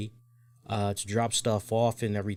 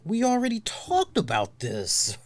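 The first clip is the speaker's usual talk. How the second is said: mock wife; 9 semitones higher than usual. Wife Tams voiced in argument.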